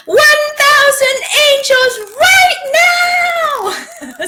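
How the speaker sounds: very high, loud, drawn-out scream; reenacted scream for help. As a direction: scream it out slowly, summoning rescue with full force. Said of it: "she just screamed out loud".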